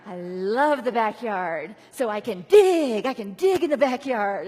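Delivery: loud, slow, delighted puppy; dog cheering at banishment; gleeful dog voice. Retold or imitated. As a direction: voice the dog thrilled to dig. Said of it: "And the dog is like".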